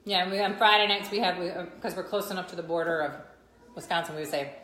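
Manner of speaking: normal talking voice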